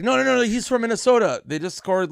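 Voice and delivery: fast, high, loud reassurance; excited bartender. Retold or imitated. Retelling the bartender reassuring the crowd, high and loud. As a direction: explain it quickly over the noise. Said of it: "he was like".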